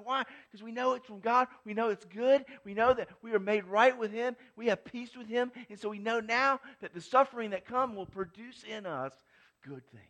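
loud, high, ecstatic pulpit climax; preaching at full force. Acted. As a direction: shout the good news home.

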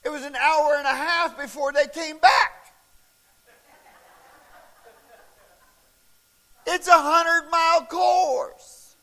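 loud, high, driving preacher voice; full-force preaching. Acted. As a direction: preach it out big to the whole room. Preaching at full force about racing.

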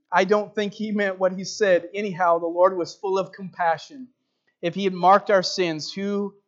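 preacher reading the testimony aloud, full voice; dramatic story reading. Acted. Preacher reading a Wigglesworth story to the congregation, dramatic. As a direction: tell the old healing story to the congregation.

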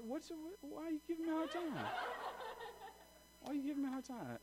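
high, soft whine; small, high voice, half amused; mock-hurt complaint. Real, not acted. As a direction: plead softly in a squeaky voice at the teasing. Mock complaint to teasing listeners.

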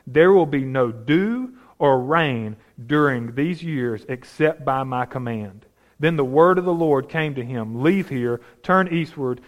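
measured, deliberate scripture reading. Acted. Preacher reading Samuel's words to Saul, measured scripture reading. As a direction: read the passage with weight. Reads scripture passage aloud.

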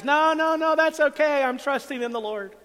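high, loud acted refusal; calling back up. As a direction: holler up to a helicopter, easing off at the end. Man calling back up to helicopter.

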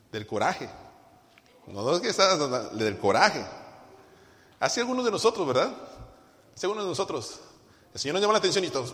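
ordinary talk, nothing marked

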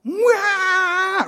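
high, loud mock baby cry; imitated baby wail. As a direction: wail like a hungry baby, held long. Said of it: "it's more like".

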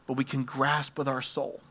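usual speaking voice

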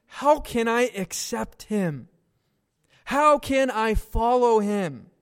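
slow, earnest questions with a hint of tears; mild tearful plea. Weeping migrant asking the pastor how to follow, slow and tearful. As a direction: ask slowly, as if near tears. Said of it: "The man starts crying and says".

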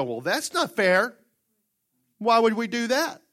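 high, loud, slow complaint; mock whining. Preacher mocking Christians who complain at trouble, loud whine. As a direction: whine like a wronged believer.

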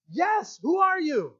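very high, acted shout; yelling back. Stranded Jack answering the voice above, yelling. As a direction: yell up to an unseen rescuer. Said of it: "Jack yelled".

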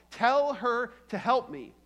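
P=A; demanding. Retold or imitated. Martha's demand, slow.

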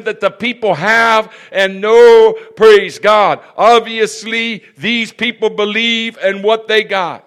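high, loud revival-style storytelling; preaching. Real, not acted. Preacher telling congregation of a passer-by, preaching fervently. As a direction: drive each phrase up and land it hard.

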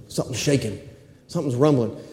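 low, very soft storytelling; hushed suspense. Acted. Preacher telling of sudden rumbling, low hushed suspense. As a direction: drop right down as if sensing a rumble.

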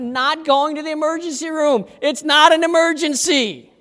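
digging in his heels; firm refusal. Retold or imitated. Pastor turning down wife's ER advice, emphatic.

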